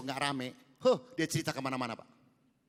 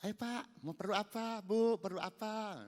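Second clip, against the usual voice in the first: friendly shopkeeper welcome; 7 semitones higher, slower than usual. Shown as a demonstration. Showing the shop lady how to greet buyers, friendly and slow. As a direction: greet the customer kindly. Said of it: "ngomongnya yang bener, jangan kasar".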